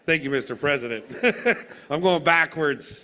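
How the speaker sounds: ordinary talk, nothing marked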